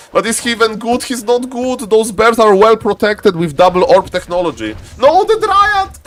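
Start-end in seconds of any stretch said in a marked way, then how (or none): 5.0-6.0 high, drawn-out cry of alarm; dismayed cry. Real, not acted. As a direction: wail it high at the sudden loss. Commentator sees a unit die.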